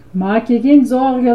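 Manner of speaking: plain reading voice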